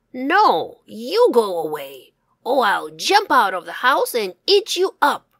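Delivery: loud, snarling fox threat; threatening fox. Acted. Fox shouting at the wolf from the house, loud and menacing. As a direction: growl it out to scare them off.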